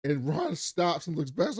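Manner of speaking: normal talking voice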